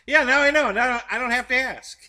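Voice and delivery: loud amused realization; gleeful now I know; excited. Real, not acted. Laughing realization.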